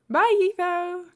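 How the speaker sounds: high, loud call over the shoulder; yelled goodbye. A narrator's reading. B-Dubs leaving Etho behind, a loud parting call. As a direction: shout it back while walking off. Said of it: "he yelled over his shoulder".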